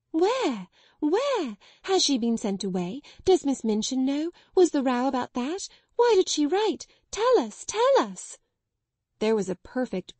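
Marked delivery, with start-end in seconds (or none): 0.1-8.4 much higher, building to shrill pleading; excited clamour. Acted. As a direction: beg for the news all at once. Girls clamour for news.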